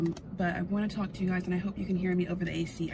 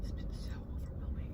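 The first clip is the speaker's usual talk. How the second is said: emotional whisper; 9 dB quieter, faster than usual. Real, not acted. Emotional about job search.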